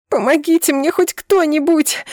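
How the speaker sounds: high, loud howl for help; frightened wail. A narrator's reading. Wife in labour calls to the rescuers, frightened wail. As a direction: wail in panic to anyone listening. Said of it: "Взвыла я больше с перепугу".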